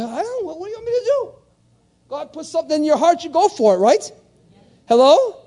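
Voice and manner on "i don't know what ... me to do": mock helplessness. Preacher answering critics about Africa, mock-helpless high protest. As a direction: plead helplessly, pitched way up.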